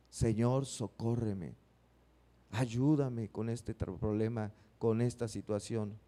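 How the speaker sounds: a touch of imitated weeping prayer; mock tearful plea. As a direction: whisper a desperate plea on your knees. Imitating people crying to God.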